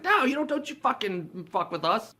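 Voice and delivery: high-pitched confrontational imitation; mock indignant women. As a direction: act out women getting in his face. Carolers confront guard, retold.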